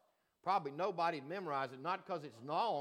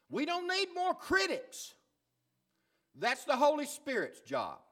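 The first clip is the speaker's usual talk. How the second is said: full-force preaching; 9 semitones higher, 9 dB louder than usual. Real, not acted. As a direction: preach it like a thundering warning.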